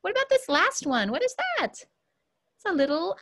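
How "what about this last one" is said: ordinary talk, nothing marked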